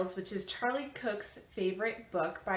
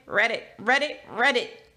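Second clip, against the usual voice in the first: frog croaking; 3 semitones higher, 6 dB louder than usual. Acted. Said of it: "and went".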